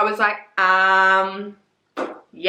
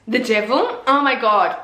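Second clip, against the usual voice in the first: ordinary delivery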